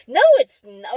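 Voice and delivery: loud, flat-out contradiction, imitated; retold stubborn denial; stubbornly convinced. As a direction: contradict as if utterly sure. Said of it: "he was so convinced".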